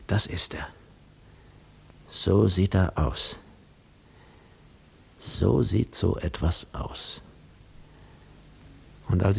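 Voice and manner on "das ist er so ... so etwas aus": hushed, slow whispering behind his back; whispered gossip. A narrator's reading. Guests whispering about Odysseus, hushed and slow. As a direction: murmur it like gossip behind a wall. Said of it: "hinter der es tuschelte".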